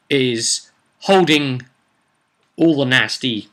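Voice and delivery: normal talking voice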